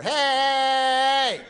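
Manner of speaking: long, high, loud hey; mock-outraged holler; indignant shout. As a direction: stretch it out in a loud mock protest. Acted sudden protest in his story.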